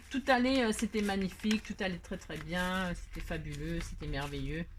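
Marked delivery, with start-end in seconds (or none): none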